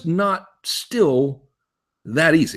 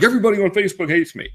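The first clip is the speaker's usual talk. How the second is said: mock dramatic wail, louder and fast; hammed-up self-pity, faster and louder; whiny overreaction; 6 semitones higher, 5 dB louder, faster than usual. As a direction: play the hurt poster who thinks everyone hates him. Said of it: "and now you're like".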